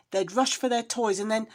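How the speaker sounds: plain reading voice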